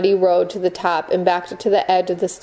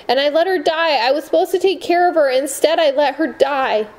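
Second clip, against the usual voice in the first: higher, tearful outpouring, slowing at the end; anguished confession; 7 semitones higher than usual. A narrator's reading. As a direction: pour out the guilt through tears. Sundara confessing while sobbing.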